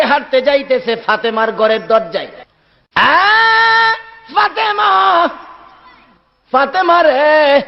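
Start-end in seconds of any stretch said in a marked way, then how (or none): 2.9-7.6 high, long-held melodic cry; calling out. Acted. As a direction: call her name plaintively at the door. Prophet calls at Fatema's door. (উঁচু সুরে টেনে ডাক)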